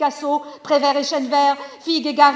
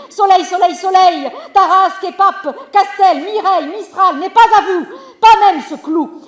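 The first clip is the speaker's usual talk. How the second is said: higher, louder, triumphant proclaiming; proud loud outburst; 6 semitones higher, 8 dB louder than usual. Acted. Mother-in-law to son-in-law, proud loud outburst. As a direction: crow it out like the lady of the manor.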